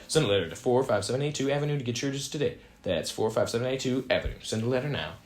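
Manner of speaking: fast, dropping address read-out; rapid ad tag. Acted. Ad voice rushing the mailing address, fast and fading. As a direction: rattle off the address quickly.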